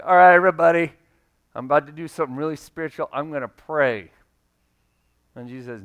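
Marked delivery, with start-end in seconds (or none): 0.0-4.1 loud, fast, self-important call to the crowd; showy announcer. Pretend. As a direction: hurry to show off how holy you are. Mocking a showy prayer.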